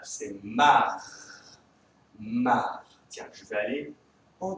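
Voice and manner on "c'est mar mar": mar said alone, cut clean from di; first syllable isolated. Shown as a demonstration. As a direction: Mar held apart as one syllable for the class. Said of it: "La première syllabe".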